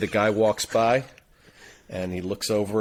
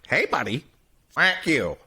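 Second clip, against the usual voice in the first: higher, squawky talking parrot; 5 semitones higher than usual. Acted. Parrot insulting a passing guy, parrot voice. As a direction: heckle like a pet-store parrot. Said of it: "the parrot looks at him and goes".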